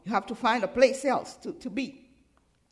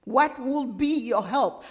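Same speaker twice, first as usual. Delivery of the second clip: ordinary delivery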